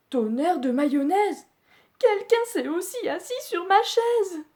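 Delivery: high indignant mother bear; a shocked, high-pitched mama; Mama Bear exclaiming. Acted. Mama Bear finding someone sat on her chair, high outcry. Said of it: "elle s'exclame".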